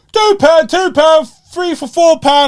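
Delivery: loud, high market-stall cry of prices; market trader calling. Retold or imitated. Street trader shouting prices at passers-by, loud sing-song cry. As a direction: belt it out like a stallholder hawking deals. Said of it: "you can hear one go".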